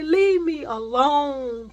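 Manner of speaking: high, loud, slowly drawn-out words; exasperated demand. Retold or imitated. Woman tells the man bothering her to go, drawn-out demand. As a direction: stretch every word out loudly, fed up.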